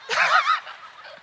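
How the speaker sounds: high rising burst of laughter. Real, not acted. Preacher laughing hard after the sock punchline. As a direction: laugh at the joke.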